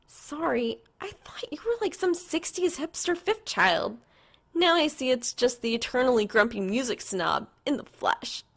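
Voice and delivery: lightly voiced girl teasing. A narrator's reading. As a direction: rib him sweetly, a hint of a young woman.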